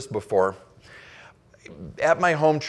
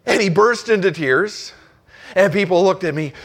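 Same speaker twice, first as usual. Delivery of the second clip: ordinary talk, nothing marked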